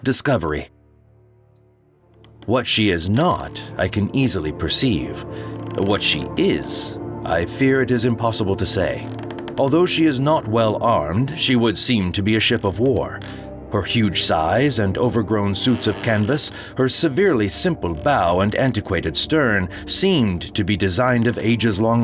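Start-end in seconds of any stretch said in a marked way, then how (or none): none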